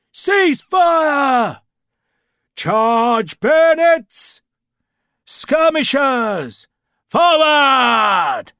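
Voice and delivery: loud, high, slow drawn-out orders; battle commands. A narrator's reading. Officer commanding the troops in battle, shouting. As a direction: bellow it over the gunfire.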